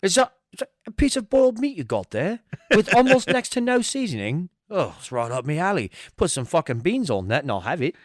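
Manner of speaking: hammed-up British accent, starting high; mock British accent. Friend mocking British love of boiled meat, hammed-up British accent. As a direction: play a Brit delighted by bland food in a British accent. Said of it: "British people might".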